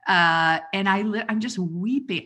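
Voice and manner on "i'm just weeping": normal talking voice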